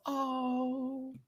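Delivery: high, soft, drawn-out coo; touched aww. Retold or imitated. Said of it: "gets so emotional".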